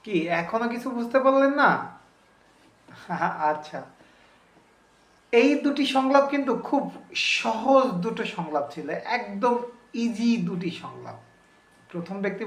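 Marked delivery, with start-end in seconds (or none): none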